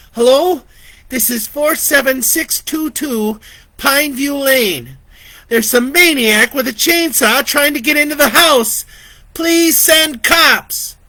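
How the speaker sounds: high, loud, frantic; panicked plea. Acted. Vicky begs the dispatcher for police, frantic and loud. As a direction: beg for help in terror.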